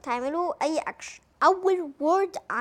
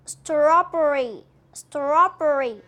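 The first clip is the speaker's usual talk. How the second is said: strawberry stretched out very slowly; slow drill; 8 dB louder, much slower than usual. Shown as a demonstration. Teacher to kids, slowly modelling strawberry. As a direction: draw out strawberry syllable by syllable. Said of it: "ننطقها صح".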